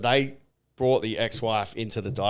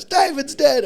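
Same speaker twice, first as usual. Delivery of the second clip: high, loud mock wail; imitated freak-out; 5 dB louder, slower than usual. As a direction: spread the alarm in mock panic. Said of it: "So she starts freaking out".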